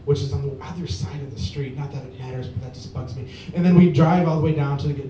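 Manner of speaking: ordinary talk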